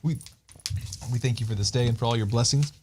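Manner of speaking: soft, earnest spoken prayer. Real, not acted. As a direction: pray quietly and sincerely. Leads an opening prayer.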